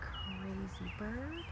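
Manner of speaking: very soft, slow mutter; slow quiet mutter; muttered under breath. Real, not acted. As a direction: mutter it to yourself. Muttered aside.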